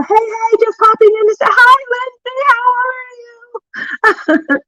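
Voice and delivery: very high singsong, last words stretched out; delighted greeting. Real, not acted. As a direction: sing out a long, lilting hello. Greets a viewer popping in.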